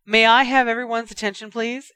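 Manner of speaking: calling out. A narrator's reading. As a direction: clap and call the room to order. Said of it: "she called, a little breathlessly".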